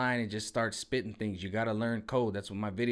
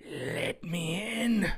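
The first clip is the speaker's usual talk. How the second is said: slow, higher creepy murmur; mock whisper; 5 semitones higher, much slower than usual. Biden imitation creeping into the mic, slow eerie murmur. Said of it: "just whispering in the mic".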